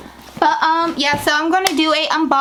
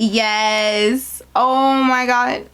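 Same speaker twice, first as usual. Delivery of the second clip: ordinary delivery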